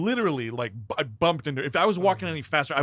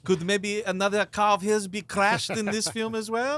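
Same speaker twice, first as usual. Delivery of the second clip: mock director; 7 semitones higher, 4 dB louder than usual. Posing as the director asking.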